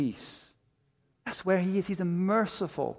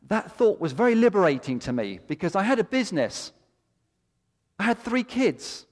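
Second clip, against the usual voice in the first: raised, ringing preacher's voice, building with conviction; fervent preaching; 7 semitones higher, 4 dB louder than usual. Acted. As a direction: preach it out with full fire. Preaching at full force.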